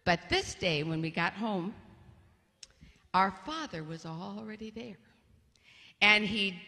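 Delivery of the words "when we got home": ordinary talk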